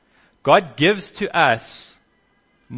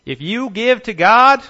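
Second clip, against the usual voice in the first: high, loud hammed-up American preacher voice; a teasing imitation of a prosperity teacher, American; mock American accent; 9 semitones higher, 8 dB louder than usual. Prosperity teacher mocked by a preacher, in a hammed-up American accent. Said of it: "I should do this in an American accent".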